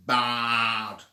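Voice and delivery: loud bleating baa; sheep baa. Acted. Goat and sheep answering Pie Weasel with a loud bleating baa.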